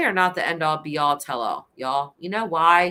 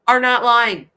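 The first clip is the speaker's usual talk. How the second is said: louder, higher, driving it home; emphatic; 7 semitones higher, 9 dB louder than usual. Real, not acted. Defending children who report abuse, loud and emphatic. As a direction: hammer "are not lying" hard. Insisting kids tell the truth.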